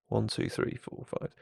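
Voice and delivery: counting under breath, fading to whisper; muttered counting. Real, not acted. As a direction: count quietly to yourself. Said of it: "counting to himself".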